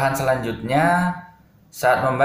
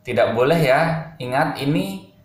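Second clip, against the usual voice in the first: ordinary delivery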